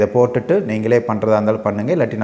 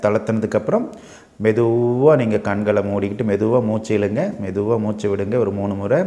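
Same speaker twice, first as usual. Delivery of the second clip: measured meditation guide, long pauses between phrases; guided meditation. Acted. Leads a guided relaxation meditation. (நிதானமா, இடைவெளி விட்டு வழிநடத்தும் தியானக் குரல்)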